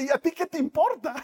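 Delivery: can't hold back the laugh as Jesus speaks; laughing while quoting. Real, not acted. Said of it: "Perdón que me ría tanto". (riendo mientras cita)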